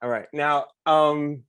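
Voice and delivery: usual speaking voice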